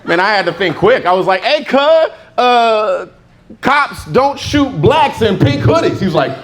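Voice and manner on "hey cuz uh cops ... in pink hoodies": mock thug voice. Retelling his quick comeback.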